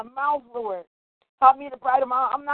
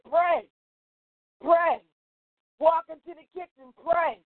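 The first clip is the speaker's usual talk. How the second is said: higher, rising preacher's exhortation; fervent preaching; 3 semitones higher than usual. Acted. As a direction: urge the church on, calling Jesus' name. Preaching builds, repeated Jesus.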